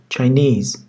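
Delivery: Chinese with the stress on "nese". Shown as a demonstration. Teacher showing students the stress, ending on "nese". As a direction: say "Chinese" with the last syllable, "nese", strong. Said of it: "Vurgu is'dedir".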